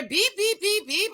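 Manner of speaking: high beeping car alarm noise; high beeps; imitated beep. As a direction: mimic the warning chime. Imitating the car's warning beep.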